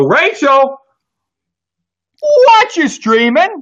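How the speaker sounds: high, loud, drawn-out slow sing-song call. Real, not acted. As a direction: call her name out like a game-show host. Host calls out to new member.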